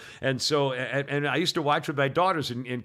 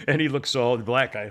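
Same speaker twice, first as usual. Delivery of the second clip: normal talking voice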